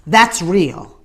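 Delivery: normal talking voice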